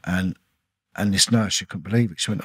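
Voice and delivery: usual speaking voice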